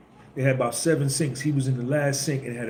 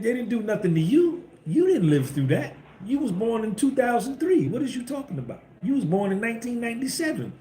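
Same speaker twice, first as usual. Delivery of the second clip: high-pitched mocking taunt; 9 semitones higher than usual. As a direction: taunt them in a high mimicking voice. Mock quote of people dismissing past grievances.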